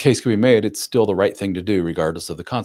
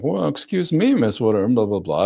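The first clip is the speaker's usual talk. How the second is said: higher-pitched, playful put-on indignation; joking mock offense; 5 semitones higher, 3 dB louder than usual. Man teasing his date back, jokingly. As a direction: tease her with a pretend huffy comeback. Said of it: "jokingly go along with that".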